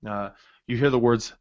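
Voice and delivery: ordinary talk, nothing marked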